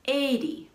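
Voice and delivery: "eighty" stressed on "eigh", weak "ty". Shown as a demonstration. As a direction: lean on the first syllable of "eighty". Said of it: "stress is on the first syllable".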